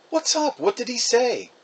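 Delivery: put-on boy pestering his mom; curious kid. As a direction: Jack asking his mom about the phone call. Jack asking Mom eagerly.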